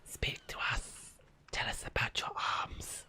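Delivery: eerie whispered seance call. Pretend. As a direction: breathe it like summoning a spirit. Spooky seance call to friend.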